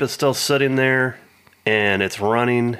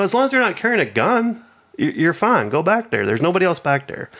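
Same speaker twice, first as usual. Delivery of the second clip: higher, impatient over-explaining; exasperated reply; 8 semitones higher than usual. Retold or imitated. Retelling his answer to the lady, mock patient and higher. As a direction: lay it out like talking to a child. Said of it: "I go".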